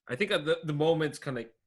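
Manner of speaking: normal talking voice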